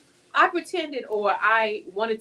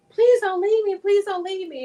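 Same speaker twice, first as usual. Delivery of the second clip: high, louder pleading cry; desperate plea; 10 semitones higher, 6 dB louder than usual. Retold or imitated. Begging God in her vision, high desperate plea. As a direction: beg not to be abandoned.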